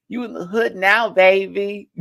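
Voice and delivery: high, loud playful imitation. Streamer trying a celebrity bit for chat, hammed-up imitation. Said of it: "Damn, can I do her voice?".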